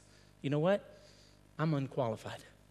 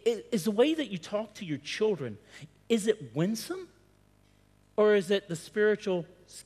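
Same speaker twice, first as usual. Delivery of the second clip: ordinary delivery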